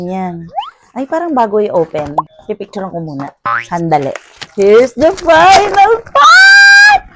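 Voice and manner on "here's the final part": high, loud drawn-out hype shout; excited announcement. Real, not acted. Vlogger reveals the final part to viewers, excited announcement. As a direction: shout it out slowly, building excitement. Announcing the last item unboxed.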